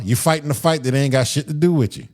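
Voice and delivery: usual speaking voice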